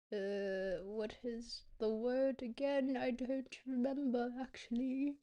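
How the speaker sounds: slow, over-enunciated British accent. Shown as a demonstration. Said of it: "I've learned a bit about British accents".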